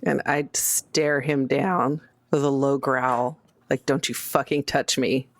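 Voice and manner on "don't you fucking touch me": ordinary talk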